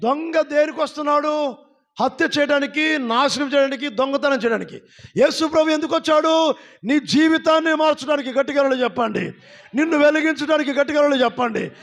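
fiery call and response preaching; preaching at full force. Real, not acted. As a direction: build the congregation up, line by line.

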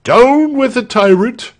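high-pitched rebel rallying cry; rallying shout. A narrator's reading. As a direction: shout it to rouse a crowd. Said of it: "shouted Walter first".

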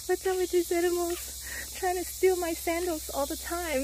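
high, loud amused complaint; laughing exasperation. Real, not acted. Annoyed at sandal-stealing monkeys.

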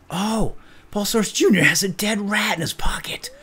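amused unvoiced whisper. Real, not acted. Player whispering about a rat in a pocket, amused. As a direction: whisper the find with glee.

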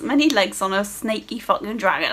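usual speaking voice

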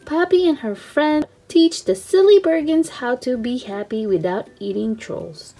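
usual speaking voice

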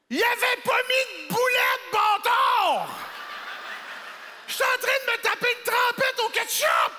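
high furious yelling; outraged shout. Retold or imitated. Stand-up comic outraged at his empty cheeseburger, shouting high. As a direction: shout in outrage at the missing patty. Outraged discovery of missing patty.

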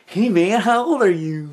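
lightly voiced flirt calling across lanes; imitating a flirty guy.

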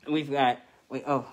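normal talking voice

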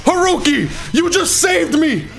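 high, shouted gratitude to the developer; overjoyed. Real, not acted. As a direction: cry out thanks like he rescued you.

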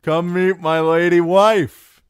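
loud, high, slurring-slow shout; boisterous tipsy announcement; drunken call. Acted. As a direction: show her off like a merry drunk. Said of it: "the singer called out drunkenly".

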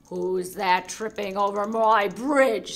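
loud, slow troll bellow. Acted. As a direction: boom it out like a troll.

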